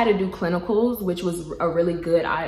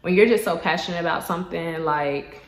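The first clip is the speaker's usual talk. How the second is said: ordinary talk, nothing marked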